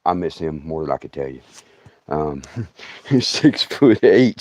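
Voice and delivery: normal talking voice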